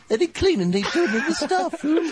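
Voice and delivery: high, slow laughing put-on voice; mock teacher. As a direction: play the fussy teacher.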